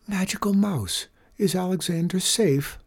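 put-on high, anxious old lady; trembling voice. A narrator's reading. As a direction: ask with a worried tremble. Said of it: "The old lady's voice trembled".

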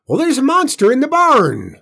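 high, loud frantic scream; hysterical scream. A narrator's reading. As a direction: shriek the news in panic. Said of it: "Aunt Bess screamed".

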